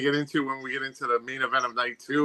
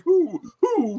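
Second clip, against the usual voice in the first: high puzzled "who"; mock the confused crowd; echoing bewildered who; imitating crowd; 12 semitones higher than usual. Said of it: "That's what the crowd said".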